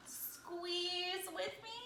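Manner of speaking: very slow, stretched invitation to squeeze; drawn-out squeeze. Shown as a demonstration. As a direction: stretch the word out slowly for the kids. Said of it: "can you guys".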